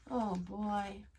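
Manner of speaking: plain reading voice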